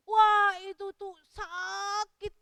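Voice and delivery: "sakit" stretched long, after a loud "Wah"; drawn-out emphasis on pain. A narrator's reading. Storyteller tells children chemo hurts, stretching "sakit" emphatically. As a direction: draw "sakit" out slowly to make the pain vivid. Stressing how painful chemotherapy is.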